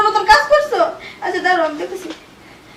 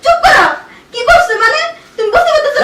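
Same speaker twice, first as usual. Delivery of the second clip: high, raised angry voice; furious confrontation; 6 semitones higher, 4 dB louder than usual. Acted. Wife silencing husband's denial, furious and loud. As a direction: cut them off angrily.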